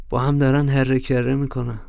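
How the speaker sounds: ordinary delivery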